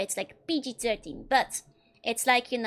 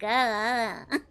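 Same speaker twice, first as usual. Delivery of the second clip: lightly put-on anime "ara ara" voice; mock-seductive mommy impression; sexy mommy voice; 3 semitones lower than usual. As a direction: play a hint of the teasing anime big sister. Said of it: "one of those sexy mommy voices".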